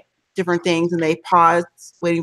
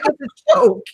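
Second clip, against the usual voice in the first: high exasperated protest; 13 semitones higher, 4 dB louder than usual. Retold or imitated. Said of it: "I'm like".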